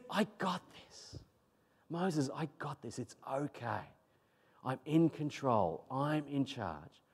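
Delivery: soft, slow, in control; calm reassurance. Acted. Preacher as God settling a frustrated Moses, calmly reassuring. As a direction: hush his worry with quiet certainty.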